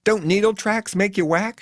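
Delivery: high, chanted confrontation; sloganeering. A narrator's reading. Faye confronts the hitchhiker with slogans, high. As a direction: throw it out like a slogan. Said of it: "sloganeering confrontation".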